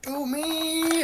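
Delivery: high, loud eager cry; volunteer eagerly; eager shout. Real, not acted.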